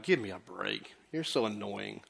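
low, quiet muttered put-down; muttered aside. Retold or imitated. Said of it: "I look and go like".

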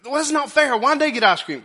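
put-on high, loud childish whine; whiny child. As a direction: whine like a kid denied ice cream. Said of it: "our kids is right when".

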